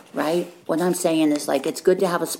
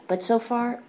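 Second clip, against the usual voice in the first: ordinary talk, nothing marked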